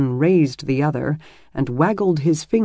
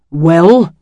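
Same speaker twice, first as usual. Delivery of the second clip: short, louder, irritated growl; 9 dB louder than usual. A narrator's reading. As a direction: snap it at the intruding brother. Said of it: "Dylan growled".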